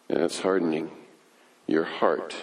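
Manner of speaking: quiet refrain. Acted. Preacher warning the congregation, hushed and low. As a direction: let the refrain fall to a hush.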